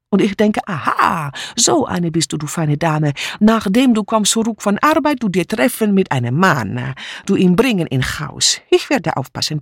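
put-on accented broken German with lively pitch swings; agitated refugee maid relishing her tale; triumphant, dramatic foreign maid. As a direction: tell it as a juicy accusation. Mitzi tells what she overheard.

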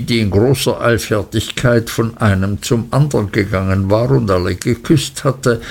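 plain reading voice

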